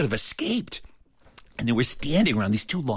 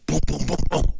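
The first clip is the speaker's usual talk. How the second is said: timid knocking sounds; 4 semitones higher, 4 dB louder than usual. Retold or imitated. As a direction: tap it out gently, a little unsure. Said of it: "You didn't knock loud enough".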